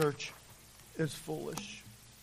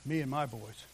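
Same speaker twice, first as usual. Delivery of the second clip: low, soft, measured refusal; acted calm firmness; 3 semitones lower, 7 dB quieter than usual. Parent telling the coach they will be in church, calmly firm. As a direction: keep it low and quiet, leaving no room for argument.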